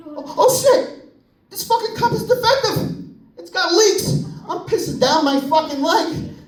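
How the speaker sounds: put-on high, panicked little-kid yelp; a boy panicking as his cup leaks, high and alarmed; mock alarm. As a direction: yelp in horror at the leak. Kid discovers leak.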